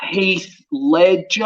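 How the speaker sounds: HEATH LEDGER very slowly, as an unfamiliar name; puzzled note-taking. Retold or imitated. Clueless actor noting a name from his agent, HEATH LEDGER slow and unsure. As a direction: stretch out "Heath Ledger", sounding out each word.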